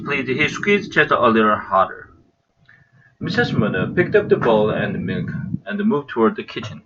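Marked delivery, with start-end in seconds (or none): none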